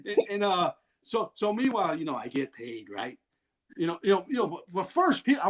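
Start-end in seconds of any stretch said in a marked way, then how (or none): none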